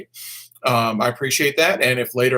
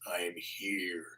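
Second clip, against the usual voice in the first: mock whisper; 9 semitones lower, 18 dB quieter than usual. Acting out a viewer's entrance.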